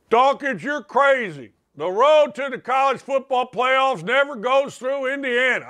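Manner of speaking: mock the neighbor who doubts Indiana; imitating a buddy. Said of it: "that's how he talks".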